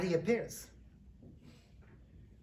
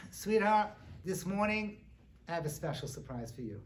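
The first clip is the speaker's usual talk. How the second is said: normal talking voice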